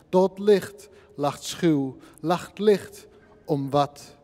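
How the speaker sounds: slow, emphatic beats with space between phrases; poem recital. Acted. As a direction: declaim each short phrase, weighting every word. (langzame, nadrukkelijke gedichtvoordracht)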